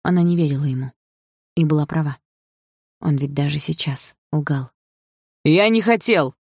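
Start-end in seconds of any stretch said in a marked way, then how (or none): 5.4-6.4 higher and louder insistence; raised, pleading insistence; insisting. A narrator's reading. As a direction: insist desperately he didn't mean it. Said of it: "твердил Игорь".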